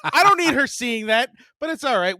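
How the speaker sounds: ordinary talk, nothing marked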